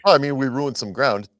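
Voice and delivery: ordinary talk, nothing marked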